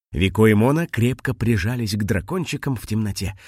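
ordinary delivery

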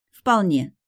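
crisp, cocky one-word answer; overconfident. A narrator's reading. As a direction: answer with too much self-assurance. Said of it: "Мой ответ прозвучал слишком самонадеянно".

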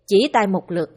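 ordinary delivery, nothing marked

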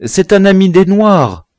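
very high, loud, flustered answer; high-voiced, panicky blurting; stammering. A narrator's reading. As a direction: blurt the explanation out in alarm. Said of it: "balbutia le citoyen".

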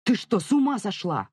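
angry, disbelieving question; exasperated shout. A narrator's reading. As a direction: scold as if appalled. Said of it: "крикнул Давид".

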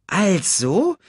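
high, perky meerkat voice; chattering meerkat. Acted. Meerkat inspecting the unconscious cub, high chattering voice. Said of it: "schnatterte es".

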